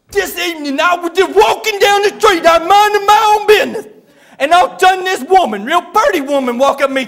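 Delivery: loud, high, worked-up tongue-tied telling; hammed-up agitated man who can't talk very good; Buddy. Buddy tells the preacher what happened, worked up and garbled. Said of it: "when he gets real worked up, he can't talk very good".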